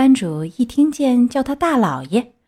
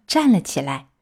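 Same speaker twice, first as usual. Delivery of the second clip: plain reading voice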